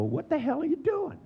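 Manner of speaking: mock-astonished question; incredulous. Asking a self-talker what he's doing, high incredulity. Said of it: "I'd go".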